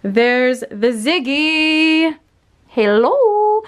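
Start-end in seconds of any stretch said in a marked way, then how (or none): 2.7-3.6 pet voice. Real, not acted. Greets the dog Ziggy.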